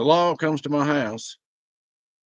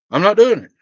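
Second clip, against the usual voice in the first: sudden high, loud flare of anger; fed up outburst; 8 semitones higher, 9 dB louder than usual. Real, not acted. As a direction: snap it out, done with it all. Fed up with constant phone calls.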